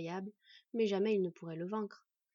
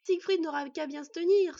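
higher, loud gleeful boast; 7 semitones higher, 10 dB louder than usual. Acted. Boy boasting about his new sword, gleeful. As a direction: crow it with delight. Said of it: "se réjouit-il ensuite".

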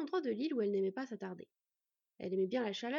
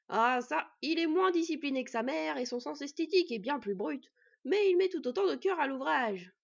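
loud, higher booming figure; hearty smith; 4 semitones higher, 8 dB louder than usual. A narrator's reading. Master smith joins the conversation, hearty and loud. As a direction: boast heartily about your apprentice.

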